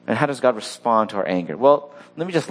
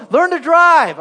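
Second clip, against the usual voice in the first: much higher and louder, a barked mock yell; mock road rage yell; 9 dB louder, slower than usual. Pastor mock-yelling at a reckless driver, angry. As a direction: yell it at the driver who cut you off. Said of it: "just tell them".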